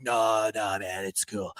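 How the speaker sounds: low, soft, dismissive reply; casual brush-off. Retold or imitated. Kid shrugging off advice about his beanie, casual brush-off. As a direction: wave the warning away quietly.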